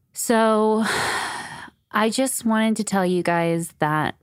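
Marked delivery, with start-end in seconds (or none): 0.9-1.6 sigh. Real, not acted. Pausing before a heartfelt message.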